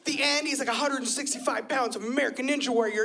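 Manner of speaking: ordinary delivery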